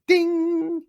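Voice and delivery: sung high ding like a bell. Retold or imitated. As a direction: chime it out high and bright. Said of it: "she could describe it like a bell went off".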